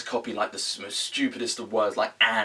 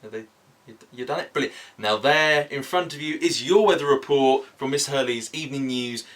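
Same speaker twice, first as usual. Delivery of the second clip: hammed-up TV weather read; mock weather presenter; 3 semitones higher than usual. Weatherman [name] presenting the evening weather to viewers, hammed up.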